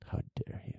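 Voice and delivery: whispering. Pretend. Mock offense at jaw insult.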